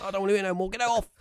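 high, strained imitation of a frightened mate; panicked refusal. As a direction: beg to be let out of it, pitched right up. Spooked mate wants headphones off.

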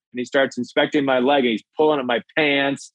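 ordinary talk

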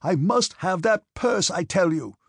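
high, loud, insistent demand; angry shout. A narrator's reading. Officer demands the purse from his orderly, angry shout. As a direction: shout the demand, stretching the words. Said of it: "shouted Denisov".

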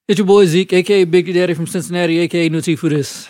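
hype intro. Acted. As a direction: announce yourself big. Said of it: "show intro hype".

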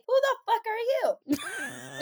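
indignant retort. Retold or imitated. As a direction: stretch it out in disbelief. Confronting man who touched her.

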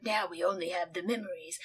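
low, hushed narrator voice; John Hurt impression. Podcaster imitating the video's narrator, low hushed John Hurt voice. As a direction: softly intone it like a film narrator. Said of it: "I keep thinking about Now John Hurt".

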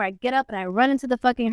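normal talking voice

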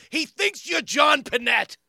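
high, loud, slow punchline shout; loud blurted retort. Retold or imitated. Comic outing friend Keegan as mistaken for someone else, loud blurted retort. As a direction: shout it out slowly to puncture his pride.

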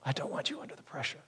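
hushed, quick imitation of a concerned wife; soft concern. Wife worried about husband's workload, hushed concern. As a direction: say it softly, almost under the breath. Voices wife's concern for him.

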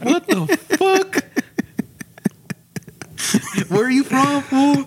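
high, slow, hammed-up tough guy; mock tough guy. Mock gang member confronting a man in a poncho.

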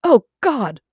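mock the posh talk, then wail in British horror; British Spike impression. Podcaster as Spike discovering he is English, in a hammed-up British accent. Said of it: "I love these impressions".

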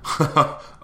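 a quick airy laugh caught off guard; laugh, surprised by the show of hands. Real, not acted. Caught off guard by the crowd's hands, airy laugh.

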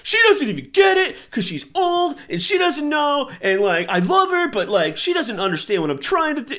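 high, loud whiny rant; whiny teen. Retold or imitated. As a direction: complain like a hurt seventeen-year-old. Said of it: "I'm like".

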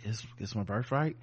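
mock meek, quiet claim that rises at the end; without authority. Host imitating his unconvincing birthright claim to her, without authority. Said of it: "he says it so without authority".

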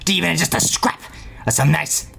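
put-on hammy old grandpa voice; eccentric old man. Grandpa bargains with the party for meat, eccentric old-man voice. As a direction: wheedle as a crazy old man.

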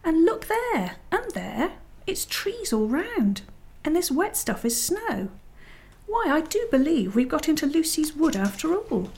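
high-pitched, dawning wonder; amazed discovery. Acted. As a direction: marvel aloud, pitch lifted high. Peter discovers the snowy wood.

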